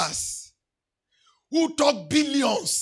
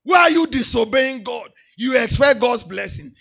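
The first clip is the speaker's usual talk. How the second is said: loud rebuke; 8 dB louder than usual. Acted. Rebuking congregation loudly.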